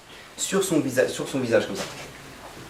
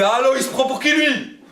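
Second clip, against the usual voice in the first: much higher, indignant mocking tone; defiant patient; 7 semitones higher, 4 dB louder than usual. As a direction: play the arrogant guy brushing off the doctor. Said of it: "Et le mec, il fait". (patient arrogant, beaucoup plus aigu et indigné)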